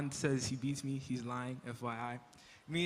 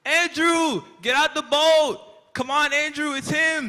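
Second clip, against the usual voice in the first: high, loud excited shout across the boat; calling out; 11 semitones higher, 12 dB louder than usual. Retold or imitated. Peter calling Andrew out of the boat, excited shout. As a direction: yell to your brother to jump out.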